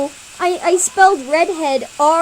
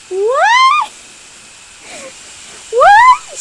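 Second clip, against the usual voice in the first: very high, loud shout; 11 dB louder than usual. Acted. Cat shouting in disbelief, very high and loud. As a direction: shriek it in disbelief. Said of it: "shouts Rover".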